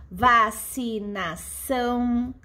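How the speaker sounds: "vacinação" slowly sounded out in separate syllables. Shown as a demonstration. Teacher giving class a polysyllable example, syllable by syllable. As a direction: draw out each syllable of "vacinação".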